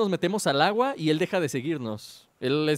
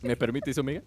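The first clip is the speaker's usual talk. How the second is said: youtuber outro; 3 semitones higher, 3 dB louder than usual. Acted. Channel outro.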